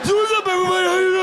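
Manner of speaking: high, strained and breathless; belly held in, squeezing it out; all in one breath. Pretend. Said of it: "do my whole show in one breath".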